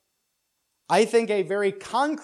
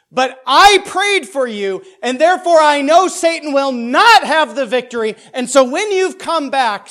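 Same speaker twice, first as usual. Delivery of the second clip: much higher and much louder, slowing on key lines; impassioned reading as Jesus; 5 semitones higher, 11 dB louder than usual. Acted. Pastor voicing Jesus promising Peter, loud and impassioned. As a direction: preach it as Jesus, full of conviction. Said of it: "do this very interpretively".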